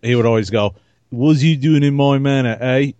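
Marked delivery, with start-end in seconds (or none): 1.1-2.9 mock English chimney sweeper, slightly higher and louder; English accent. Ribbing a friend about his manor, mock English accent. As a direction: put on a cheeky English street accent. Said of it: "he would talk in an English accent".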